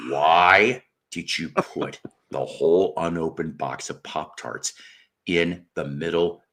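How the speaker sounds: low, measured disbelief; deliberate, restrained interrogation. Retold or imitated. Retold repeat of exasperated question.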